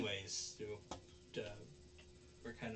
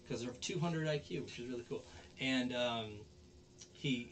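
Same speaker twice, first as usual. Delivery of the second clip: ordinary delivery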